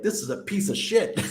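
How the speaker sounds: high, laughing mock mechanic voice; retelling the mechanics' verdict, half-laughing. Italian mechanics mocking his car.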